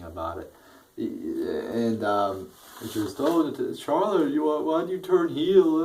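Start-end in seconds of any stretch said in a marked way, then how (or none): 3.2-5.8 high, whiny mock diva; mocking high girly voice. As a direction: parody the wrestler's pleading line. Mocking the divas' promo.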